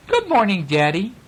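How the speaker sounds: put-on high, sugary good girl; sweet as pie to Daddy; as nice as could be. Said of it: "as nice as a girl could".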